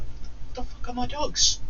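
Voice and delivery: high-pitched disbelief. Retold or imitated. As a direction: squeak it out in mock bewilderment. Retold shock at missing dogs.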